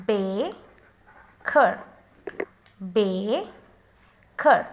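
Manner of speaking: baker slowly, long BAY, pause, then ker; stressed syllable. Shown as a demonstration. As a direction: hold the first syllable of baker and separate the second. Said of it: "So here is the stress".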